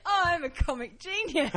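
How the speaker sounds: high-pitched, through real laughter; laughing self-praise. Real, not acted. Comic pleased with their own joke, laughing. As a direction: crow it while still giggling.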